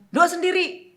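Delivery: high, loud mock refusal; sulky, cross mother. Mother refuses to pray with daughter, high and cross. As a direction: snap it like an annoyed mom. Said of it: "mama saya itu udah ... ngambek banget".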